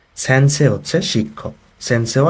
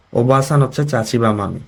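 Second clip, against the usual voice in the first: ordinary talk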